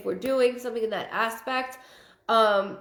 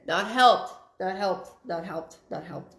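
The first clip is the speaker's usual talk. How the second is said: fading repeated correction. Retold or imitated. Reader relays his correction "not helped", fading with each repeat.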